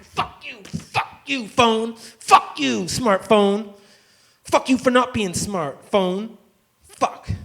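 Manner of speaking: high-pitched angry cursing at the phone; raging at phone. Acted. Cursing his smashed burner phone, in a high furious rant. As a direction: curse the phone out, drawn out.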